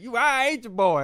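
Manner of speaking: bug your eyes and accuse; old cop again. Retold or imitated.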